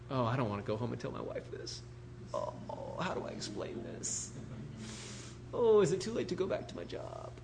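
very soft, sinking groan to himself; acted quiet dismay; dreading regret. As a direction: murmur it sheepishly, wishing to back out. Acting his dread of telling wife.

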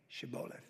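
"Shibboleth" whispered with a soft SH; "Shibboleth" barely whispered; shibboleth test word. Shown as a demonstration. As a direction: breathe out "Shibboleth" like a secret password.

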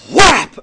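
sudden loud "whap"; imitated smack. Storyteller imitating dad's ring hitting forehead, sudden smack noise. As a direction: burst it out like a sharp hit. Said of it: "he'd just go".

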